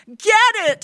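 high, loud, breathless order; panting urgency. A narrator's reading. Wife presses her husband to hurry, panting and frantic. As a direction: pant out a frantic command. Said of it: "she panted".

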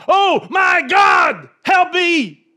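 high, drawn-out desperate shout; hammed-up terrified yell to heaven; crying out for help. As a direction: bellow a slow, high-pitched plea for rescue. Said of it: "he cried out".

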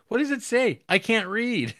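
high, loud mock confusion; comic yokel voice. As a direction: squeal it like a baffled illiterate peasant. Retold funny peasant line.

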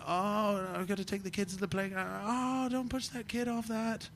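soft, high parental fretting; small high voice, fussing under the breath; harried parent. Retold or imitated. Dad acting out playground duty with twins, soft high fretting.

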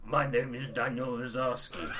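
put-on accented actor's introduction; Rutger Hauer. Imagining an actor's blooper in a game, imitated voice. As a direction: announce the name in a rough imitation of the actor. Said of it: "it would be Rutger Hauer, like".

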